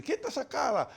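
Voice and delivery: put-on high, loud angry scolding of a child; scolding mother. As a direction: snap at the sad child like an impatient mother. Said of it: "la mamá comienza a decirle". (madre regañando a gritos, aguda)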